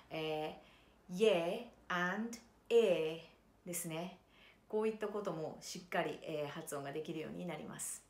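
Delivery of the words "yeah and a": "yeah and a" stretched very slowly, leaning on the Y glide; drawn-out Y glide. Shown as a demonstration. Voice teacher demonstrating semivowel Y words, very slow and deliberate. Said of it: "Yを用いた単語ですと".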